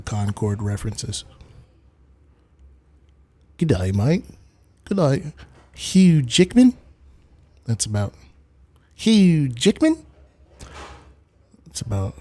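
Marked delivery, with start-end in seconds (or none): 3.6-6.7 hammed-up bad Australian accent; badly faked Aussie greeting with bent vowels. Shown as a demonstration. Streamer humoring chat, attempting an Aussie accent. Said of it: "Keep the Aussie accent". 8.9-10.0 very slow, hammed-up Australian vowels; bad Aussie accent. Shown as a demonstration. Streamer showing his limited Aussie accent, hammed up. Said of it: "I can't do an Aussie accent".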